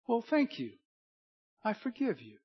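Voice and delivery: high, soft owner voice; parrot's owner. Acted. Owner forgiving the apologetic parrot, high and soft. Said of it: "Owner looks at him and goes".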